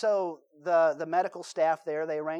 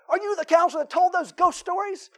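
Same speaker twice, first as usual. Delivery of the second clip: much higher, louder accusing voice; upset mother; 12 semitones higher, 9 dB louder than usual. Retold or imitated. Mother confronting the counselor after church, upset and accusing. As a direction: confront him, indignant.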